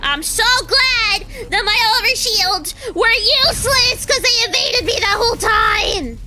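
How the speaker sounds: loud, high, furious sarcasm; sarcastic yelling. Real, not acted. Gamer raging about useless overshields, sarcastic yelling. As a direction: shout your fake gladness.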